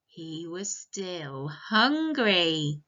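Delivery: drawn-out, very slow storybook line; slow dramatic refrain. Acted. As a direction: stretch it out for the little ones. Story refrain read slowly.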